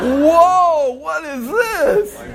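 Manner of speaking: very high and loud, laughing, then slow; astonished whoa. Retold or imitated. Preacher reliving the coincidence for the congregation, laughing amazement. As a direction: stretch out the wonder of it.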